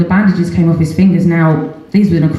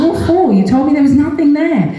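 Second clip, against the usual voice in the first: indignant question; 9 semitones higher than usual. Retold or imitated. Mother arguing with the doctors.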